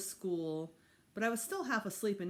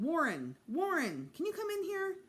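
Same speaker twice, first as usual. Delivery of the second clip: calling out; 10 semitones higher than usual. A narrator's reading. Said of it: "went to my door and called".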